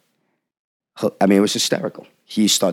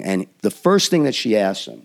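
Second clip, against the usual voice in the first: usual speaking voice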